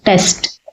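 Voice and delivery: టెస్ట్ whispered, the final t crisp. Shown as a demonstration. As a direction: breathe out టెస్ట్ stressing the last t.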